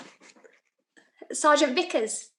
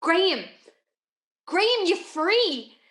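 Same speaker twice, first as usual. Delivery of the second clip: calling after him; 6 semitones higher than usual. Acted. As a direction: call out to him, elated. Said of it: "I kept calling after him".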